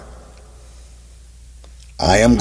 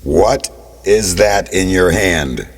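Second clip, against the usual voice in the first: booming voice of God; 6 semitones lower, 5 dB louder, slower than usual. Acted. God speaks to Moses.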